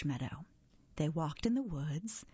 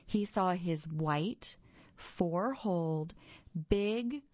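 ordinary delivery, nothing marked

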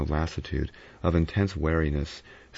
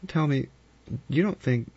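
Vera, hesitant; 9 semitones higher than usual. Acted. Vera breaks off anxiously.

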